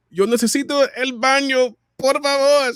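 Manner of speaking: put-on desperate kid begging for the bathroom; kid asking in Spanish. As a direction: blurt it out urgently in Spanish. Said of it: "how to say this in Spanish".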